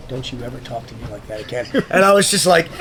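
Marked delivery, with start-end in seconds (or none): 0.1-1.7 low, hushed imitation of a mentor's rebuke; quiet stern warning. Mentor rebukes him during a handshake, low and quiet. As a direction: drop pitch and volume to warn.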